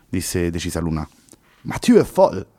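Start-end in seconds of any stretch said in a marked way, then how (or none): 1.6-2.5 high, loud panicked cry with a French twist; Henry balks in alarm; desperate exclamation. Acted. Henry protests to Luna in desperate panic. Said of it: "esclamò disperato il ragazzo".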